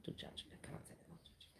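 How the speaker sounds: hushed confessing whisper; guilty whispered aside. Real, not acted. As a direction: let it slip out under the breath. Confessing judgment quietly.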